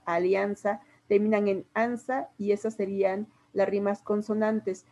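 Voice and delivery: ordinary talk, nothing marked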